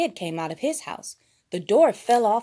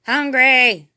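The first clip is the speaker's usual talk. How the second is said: loud, higher roar; bellow; 6 semitones higher, 13 dB louder than usual. A narrator's reading. Masked menace bellowing at the town, loud. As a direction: bellow it like a monster. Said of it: "bellowed the masked menace".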